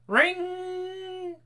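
high mimicked doorbell ring. Narrator mimics the doorbell at night. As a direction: sing out the bell's ring.

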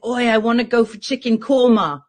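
put-on light British accent on the quote; British mate voice. Tommy announcing his dinner plan, lightly British. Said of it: "he's like".

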